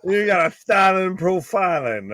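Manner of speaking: high wrestler catchphrase voice; Ric Flair impression. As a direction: crow it like a strutting wrestler. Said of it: "you do Ric Flair of the consortium".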